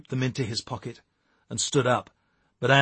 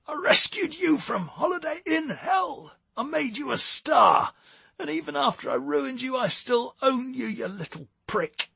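high-pitched, furious bellow; 14 semitones higher than usual. A narrator's reading. Jerry berates Stewart after he attacked her, bellowing. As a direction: rage at him in a strained, high voice. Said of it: "she bellowed".

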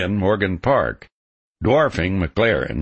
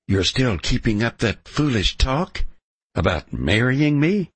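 woman's voice; 7 semitones higher than usual. A narrator's reading.

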